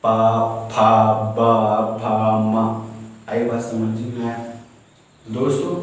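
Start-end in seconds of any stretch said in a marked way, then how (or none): 0.0-2.6 पा फा बा फा मा with the lips closing, the middle slow; demonstrated lip sounds. Shown as a demonstration. Teacher demonstrating lip sounds to the class, drawn out. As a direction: press the lips for पा फा बा फा मा. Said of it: "पा बोलने से मेरे".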